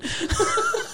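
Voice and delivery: shrieking high laugh; cracking up at her own joke, squealing. Real, not acted. Laugh after rude retort.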